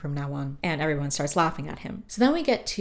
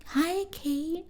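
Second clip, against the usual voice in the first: put-on sweet baby-talk greeting; 12 semitones higher than usual. [name] sneaking in to coo at baby Kate. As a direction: coo it to a newborn.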